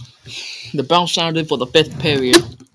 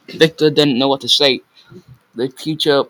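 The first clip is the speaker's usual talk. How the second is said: usual speaking voice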